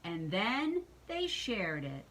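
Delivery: plain reading voice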